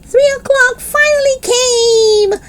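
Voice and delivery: ordinary delivery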